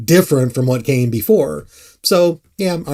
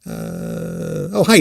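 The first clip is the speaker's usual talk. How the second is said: ordinary talk, nothing marked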